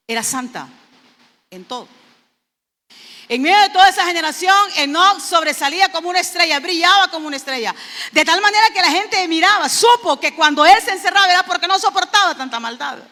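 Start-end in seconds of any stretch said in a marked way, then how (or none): 3.3-13.0 fast, loud, raised preaching voice; fervent preaching. Acted. Preacher exhorting the brethren about Enoch, loud and fervent. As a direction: preach it out to the congregation. Preacher rising to full force.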